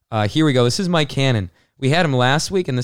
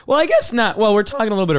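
voice crack; 8 semitones higher, faster than usual. Real, not acted. Host's voice cracks while changing topic. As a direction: voice cracks up high. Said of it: "voice crack".

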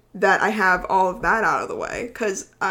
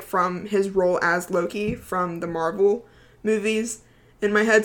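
ordinary talk, nothing marked

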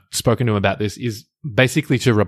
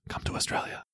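sly whispered aside; whispered invitation; 14 dB quieter than usual. Real, not acted. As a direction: slip it in under your breath as a teasing lure.

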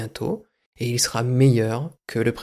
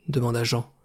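normal talking voice

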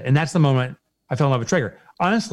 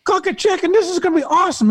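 much higher, gushing Southern customer-service voice; excited Southern rep; 3 dB louder than usual. Retold or imitated. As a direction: play the overjoyed phone guy from the South. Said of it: "because of the guy's accent".

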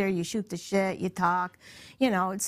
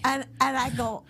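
ordinary talk, nothing marked

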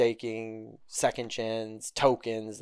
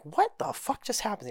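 retold shock; 11 semitones higher than usual.

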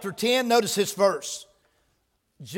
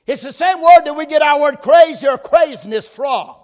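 higher, louder on "crazy"; preaching emphasis; 4 semitones higher, 7 dB louder than usual. Acted. Preacher hammering the word to the church, loud. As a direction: punch "crazy" out.

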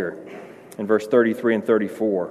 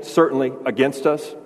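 ordinary delivery

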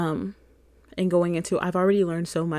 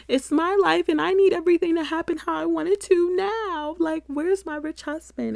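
mock whiny demand; 9 semitones higher, 4 dB louder than usual. Real, not acted. Playful demand, much higher.

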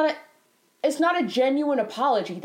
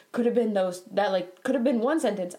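ordinary talk

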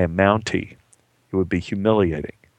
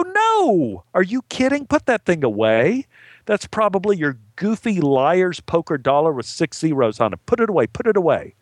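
alarmed refusal; 6 semitones higher than usual. Acted. Actor refusing the gambler's dollar game, alarmed protest. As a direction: refuse with exaggerated, high-pitched alarm.

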